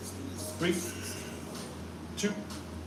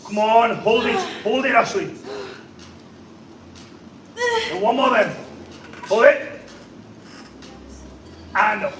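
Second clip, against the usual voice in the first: loud, higher spotter calls; urgent shout; 6 semitones higher, 4 dB louder than usual. Real, not acted. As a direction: drive the last rep. Final reps, spotter yelling.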